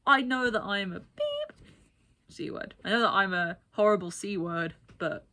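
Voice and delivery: retelling the man's smug excuse, mock defiant; imitating the man. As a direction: own it like a proud jerk. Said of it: "He was like".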